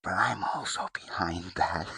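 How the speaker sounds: ordinary talk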